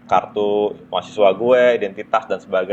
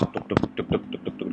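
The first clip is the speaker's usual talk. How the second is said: heartbeat; 2 semitones lower, 10 dB quieter than usual. Retold or imitated. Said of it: "Deg-degan gua kan".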